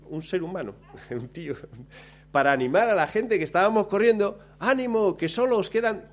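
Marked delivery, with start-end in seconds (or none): 4.6-6.1 high, bright call of encouragement to the runners; cheering spectator. Retold or imitated. A spectator shouts encouragement to the struggling runners, high cheering call. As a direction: cheer them on like a roadside fan.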